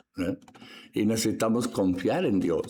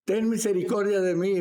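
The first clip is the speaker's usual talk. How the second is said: mock shout, pitched way up; imitated cry for help; 9 semitones higher than usual. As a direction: call out like the blind beggar. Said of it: "empezó a gritar".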